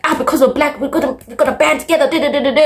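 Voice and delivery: high, lightly mocking voice; a touch of sarcasm, parroting a silly idea; mocking assumption.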